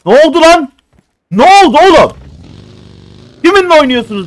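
loud, high gloating yell; triumphant shout. Real, not acted. Taunting the enemy squad after a kill, loud gloat. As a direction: crow at the beaten enemies.

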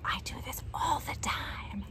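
soft whispered confession; whispered aside. Acted. Admitting to kids she dances alone, whispered. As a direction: whisper it like a little secret.

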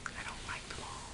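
breathy private whisper to herself; whispered aside. Real, not acted. Dreamer whispering an aside about the mall. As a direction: drop to a whisper for a quick aside.

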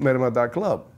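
normal talking voice

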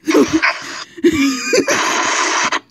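big squealing laugh. Real, not acted. Reader cracking up at her own burp, shrieking laugh.

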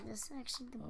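soft unvoiced whisper; hushed aside. Real, not acted. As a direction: drop to a whisper mid-sentence. Whispered aside while playing.

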